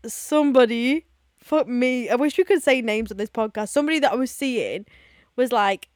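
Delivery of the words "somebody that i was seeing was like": ordinary talk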